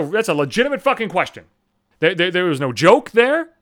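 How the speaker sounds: usual speaking voice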